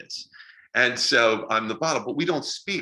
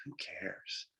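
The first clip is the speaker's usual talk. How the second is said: silent scoff; 3 semitones lower, 17 dB quieter than usual. Retold or imitated. Inner thought while friend pitched.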